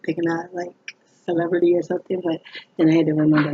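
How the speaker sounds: normal talking voice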